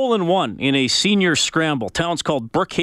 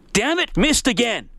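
higher, mock-exasperated divine voice; voice from heaven; 8 semitones higher than usual. God missing the pastor's target, mock frustrated. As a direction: lightly play God echoing the golfer's curse. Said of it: "a voice from the heavens says".

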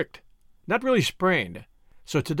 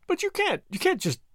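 astonished cry; 5 semitones higher than usual. A narrator's reading. Tuppence objecting to Julius buying a Rolls Royce, astonished protest. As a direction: blurt it out in disbelief, voice shooting up. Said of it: "cried Tuppence".

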